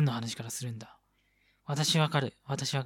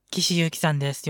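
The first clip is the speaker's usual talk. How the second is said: ordinary delivery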